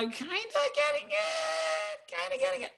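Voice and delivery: playful falsetto hedging; tiny squeaky voice. Pretend. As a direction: squeak it out softly, unsure. Voicing unsure students' middling answer.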